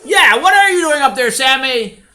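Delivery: put-on high, loud child calling up; calling kid. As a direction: call up to a boy on a ladder. Boys call up the ladder.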